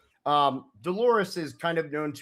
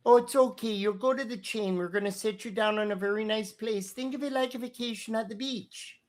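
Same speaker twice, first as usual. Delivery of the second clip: motherly receptionist; 5 semitones higher than usual. Acted. Dolores soothing nervous Rufus.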